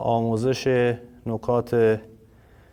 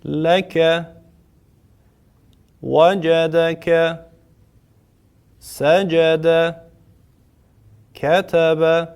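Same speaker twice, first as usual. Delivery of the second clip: higher and louder, slow even chant; simple recitation tune; 5 semitones higher, 4 dB louder, much slower than usual. Shown as a demonstration. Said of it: "به صورت لحن ساده این چهار کلمه رو برای دوستان میخونم". (خواندن با لحن ساده، بلندتر و آهسته)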